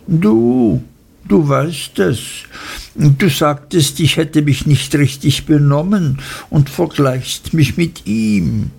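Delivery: lightly voiced tearful Dora; tearful young wife. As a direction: play her wounded and accusing. Dora accuses husband.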